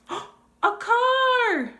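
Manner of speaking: high, loud thrilled cry; excited exclaim. Shown as a demonstration. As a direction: burst out with delight. Excited discovery of car.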